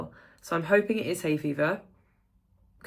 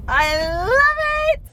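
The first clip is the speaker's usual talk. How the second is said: high, loud joyful squeal; delighted squeal; 12 dB louder, much slower than usual. Real, not acted. Vlogger squealing to camera about the light evening. Joy leaving yoga in daylight.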